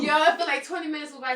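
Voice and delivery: loud fast insistence; emphatic retelling. Real, not acted. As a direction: hammer the point home excitedly.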